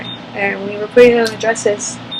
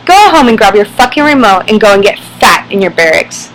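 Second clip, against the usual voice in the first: loud, harsh repeat of the insult in a put-on sergeant voice; sergeant's angry yell; 8 dB louder than usual. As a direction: bellow it like a drill sergeant humiliating her.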